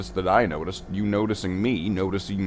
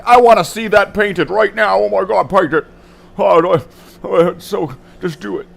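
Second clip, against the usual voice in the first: much higher and louder, gushing and impatient; eager viewers; 10 semitones higher, 9 dB louder than usual. Retold or imitated. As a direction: mimic fans begging him to paint it now. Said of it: "and they're like".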